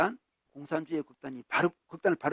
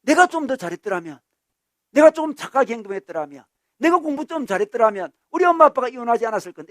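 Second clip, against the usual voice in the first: loud, raised-pitch regret; self-blame; 6 semitones higher, 9 dB louder than usual. Acted. Child blaming self for parents' divorce, loud regret. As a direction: act out a child wishing they had done better. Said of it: "내 때문이라고 또 생각을 해요".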